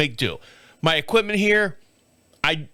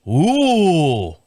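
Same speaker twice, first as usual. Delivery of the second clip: usual speaking voice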